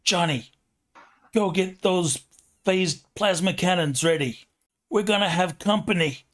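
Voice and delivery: put-on New York accent. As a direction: bark orders like a New York mother. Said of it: "In a super thick New York accent".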